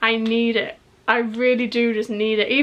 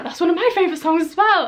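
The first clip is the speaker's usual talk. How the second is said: higher, quick burst of enthusiasm; excited reaction; 7 semitones higher, faster than usual. Retold or imitated. Fan reacting to singer's favourite song pick, excited. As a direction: blurt it out, pitch raised, delighted.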